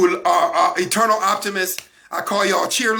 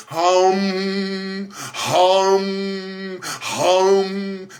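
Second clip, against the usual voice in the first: mock meditation hum; 2 semitones lower, much slower than usual. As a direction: hum like a parody yogi.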